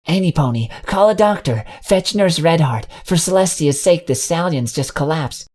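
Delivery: high, urgent plea for help; high frantic call for a doctor; panicked calling out. Acted. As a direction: call out to anyone nearby, frantic.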